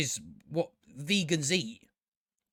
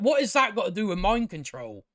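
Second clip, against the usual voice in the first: usual speaking voice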